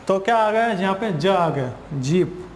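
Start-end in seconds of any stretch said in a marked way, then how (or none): none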